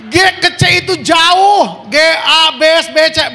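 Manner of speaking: high, loud comic rant; exasperated outburst. Retold or imitated. Exasperated rant about the wrong key. (teriakan kesal yang jenaka)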